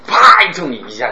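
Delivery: a sudden loud jolt after the hush; sudden burst. Shown as a demonstration. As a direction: jump out at them. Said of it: "你就感觉害怕".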